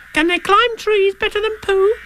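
tiny, very high squeaky youngster; high-pitched eager little voice; Roo's voice. Acted. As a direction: squeak the question like a curious little one. Roo questions Tigger.

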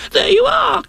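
triumphant high squeak; Piglet. Acted. Piglet proved right, triumphant squeal. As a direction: squeal it in vindication.

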